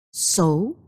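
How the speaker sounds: ordinary delivery, nothing marked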